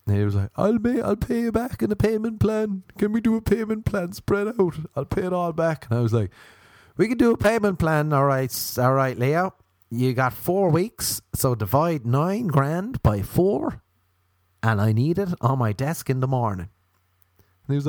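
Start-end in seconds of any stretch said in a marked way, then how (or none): 0.6-5.9 much higher, begging voice; pleading friend. Retold or imitated. 7.0-16.7 mock debt collector. Pranker plays debt collector.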